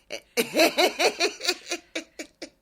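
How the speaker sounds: long high-pitched laughing; laughter. Real, not acted. Co-host amused by the retold gym confrontation, laughing. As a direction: burst out laughing, high and sustained.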